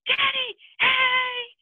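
put-on high, loud little-kid greeting; loud, drawn-out high mock kid voice; imitated excited child. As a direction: squeal it out to dad like a small child. Acting child greeting his father.

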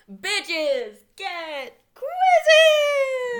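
high, loud, stretched-out announcer cry; whooping game-show intro; show-title shout. Acted. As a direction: belt out the title, holding the last word.

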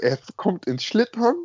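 usual speaking voice

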